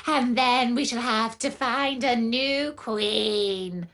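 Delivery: cackling hyena. Acted. The hyena taunts the trapped lioness, gloating and high. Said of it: "He cackled with his ugly voice and taunted".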